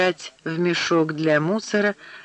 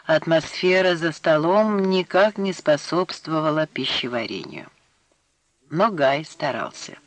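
ordinary delivery

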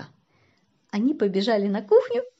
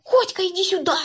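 высокий зов товарища; позови повыше, будто из другой комнаты; внезапный крик; 9 semitones higher than usual. A narrator's reading. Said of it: "и вдруг закричал". (звать криком)

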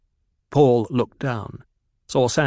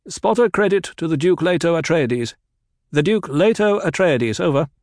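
loud, higher formal announcement; radio announcement; 7 semitones higher, 5 dB louder than usual. Acted. Kynes crediting the Duke to the crews, loud and formal. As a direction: proclaim the Duke's name over the radio.

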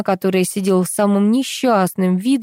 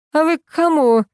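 raised, higher-pitched challenge; understated shouted question; mild shout; 8 semitones higher, 3 dB louder than usual. A narrator's reading. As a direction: call it out sharply, as a narrated shout. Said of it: "крикнула появившаяся в коридоре тучная особа".

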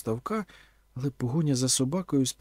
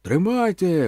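loud, raised shout; calling out; 6 semitones higher, 7 dB louder, slower than usual. A narrator's reading. Vasyl throwing an oar to shore, calling out. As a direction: holler it across the water. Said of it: "гукнув Василь".

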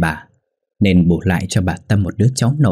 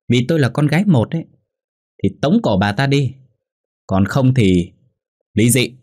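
higher-pitched, insistent angry ultimatum; shrieking wife; 5 semitones higher than usual. A narrator's reading. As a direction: lay down the law in a heated, raised pitch. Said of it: "Hòa rít lên".

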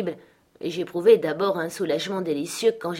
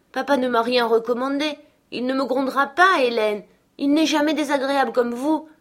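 plus haut et plus fort, boudeuse; fillette vexée; 7 semitones higher, 5 dB louder than usual. Acted. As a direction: Catherine réplique à Hélène, froissée. Catherine répond. (gamine vexée qui proteste fort)

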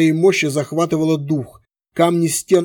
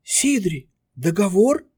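stammering; 5 semitones higher, slower than usual. A narrator's reading. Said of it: "пролепетала Тави".

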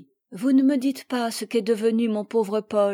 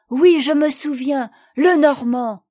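loud, eager and higher; excited recognition; 4 semitones higher, 8 dB louder than usual. Acted. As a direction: call it out as it comes back to her. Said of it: "ajouta-t-elle vivement". (avec vivacité, plus fort et plus aigu)

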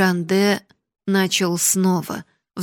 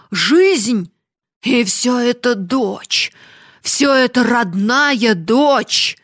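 put-on agitated old miser; 3 semitones higher, 6 dB louder than usual. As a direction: panicky old miser wailing.

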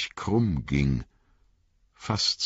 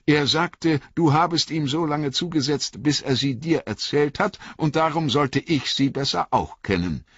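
put-on higher, lighter young hobbit voice; earnest young hobbit, pitched up; young Frodo; 9 semitones higher than usual. A narrator's reading. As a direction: lift the voice for eager young Frodo.

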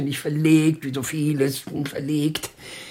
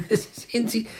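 flustered laugh; 10 semitones higher than usual. Acted. Old man can't find his keys.

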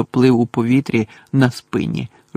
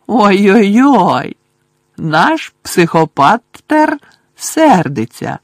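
much higher, louder, slow sing-song jeer; high slow poltergeist mockery; Peeves mocking; 7 semitones higher, 7 dB louder, much slower than usual. A narrator's reading. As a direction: tease him with drawn-out glee. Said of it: "глузував Півз".

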